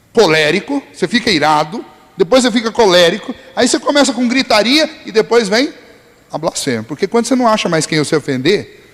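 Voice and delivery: normal talking voice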